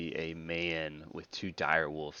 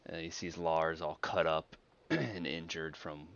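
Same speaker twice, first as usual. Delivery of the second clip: ordinary talk, nothing marked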